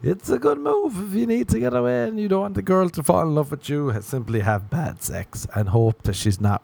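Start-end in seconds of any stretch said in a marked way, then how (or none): 0.1-3.9 way up high, mock helpful; high sarcastic advice. Comic gives fake dating advice in a high voice. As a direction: sell the bad tip high and bright.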